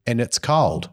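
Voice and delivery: ordinary delivery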